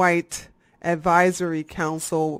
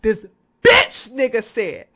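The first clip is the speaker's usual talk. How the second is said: louder, punched correction; 3 semitones higher, 7 dB louder than usual. Shown as a demonstration. Correcting himself, louder on bitch. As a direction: hit the word bitch hard. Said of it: "Excuse me, that was wrong".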